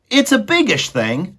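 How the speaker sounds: put-on high, loud servant-girl voice; Meg's voice. Maid Meg answering Roscuro, high and loud. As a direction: squeak it out as the simple maid.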